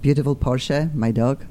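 ordinary talk

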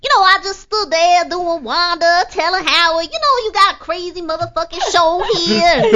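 high, loud, brash comedian impression; Wanda Sykes. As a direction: belt it out high and punchy as a celebrity impression. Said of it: "in Wanda Sykes' voice".

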